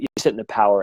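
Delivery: ordinary talk, nothing marked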